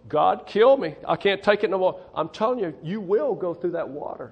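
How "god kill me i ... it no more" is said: despairing cry. Retold or imitated. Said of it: "them fellows".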